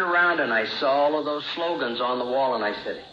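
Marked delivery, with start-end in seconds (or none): none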